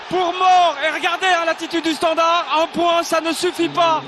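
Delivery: high, loud, breathless call; excited commentary. Real, not acted. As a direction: keep riding the goal high. Excited call after goal. (commentaire surexcité)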